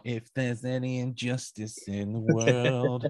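slow, drawn-out stand-up delivery; mock stand-up comic reading the line slowly; stand-up impression. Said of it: "reminds me of Lenny Henry doing stand-up".

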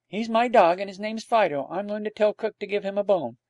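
put-on high-pitched boy laying claim to his dog; lightly voiced insistent boy. A narrator's reading. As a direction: insist like a determined child.

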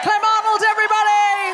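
big high announcer call; announcer shout. Real, not acted. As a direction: hype up the crowd, shouting. Host announces act, shouting.

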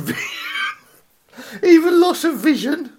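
high-pitched, laughing disbelief; breaking into laughter. Real, not acted. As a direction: crack up while reading it out. Breaking up at the headline's details.

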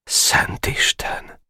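slow breathless whisper; hushed dismay; whispered alarm; shocked whisper. Acted. Boka shocked at what he sees.

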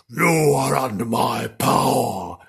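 slow, drawn-out demon boom; big, slow demon incantation; big demonly voice. Acted. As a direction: make it huge and demonic, stretching each word. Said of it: "something big and".

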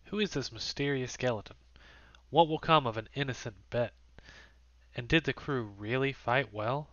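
hushed teaser narration; cliffhanger hushed stop. A narrator's reading. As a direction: dangle the question, then sign off. Game master's episode outro.

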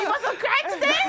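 laughing. Pretend. Mock kids host bit.

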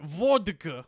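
hammed-up Russian accent; put-on Russian pronunciation. Shown as a demonstration. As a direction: get into a thick Russian accent. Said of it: "Let me see if I can get into it".

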